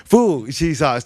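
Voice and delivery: usual speaking voice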